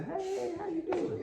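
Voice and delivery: lightly sung high falsetto hello; high friendly greeting. Retold or imitated. Preacher mocking an overly sweet greeting to a neighbor. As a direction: chirp it up sweetly. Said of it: "I'm gonna say".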